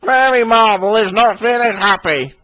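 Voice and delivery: high helicopter voice, explaining; explaining Harold. Acted. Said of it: "explained Harold".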